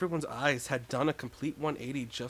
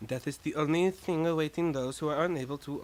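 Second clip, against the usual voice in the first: ordinary delivery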